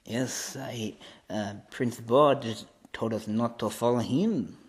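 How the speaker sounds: put-on hushed French-accented English. French royal guard answers Odin, French accent. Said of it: "the only French person in Asgard".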